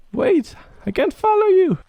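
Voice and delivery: high, loud plea to wait; calling out. Real, not acted. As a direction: yell after it so it stops. Calling after fleeing creature.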